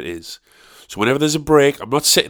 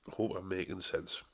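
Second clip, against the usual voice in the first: a much softer echo of the line; much softer repeat; 4 semitones lower, 18 dB quieter than usual. Shown as a demonstration. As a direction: drop the level way down, as if to himself.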